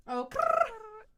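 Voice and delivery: oh then high loud rolled krrr. Shown as a demonstration. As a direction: punch the krrr. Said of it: "repeating louder".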